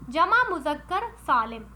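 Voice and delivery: normal talking voice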